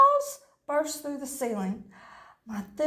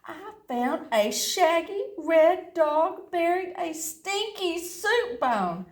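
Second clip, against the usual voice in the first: ordinary delivery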